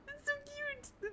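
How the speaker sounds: high, slow cooing; squeaky delighted coo; cute squeal. Real, not acted. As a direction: coo it, then catch yourself. Gushing, then correcting to awesome.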